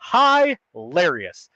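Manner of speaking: very slow, stretched "hilarious"; drawn out. Real, not acted. Son mocking dad's boomer letter, "hilarious" stretched very slowly. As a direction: drag out every syllable of "hilarious". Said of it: "repeated word, drawn out".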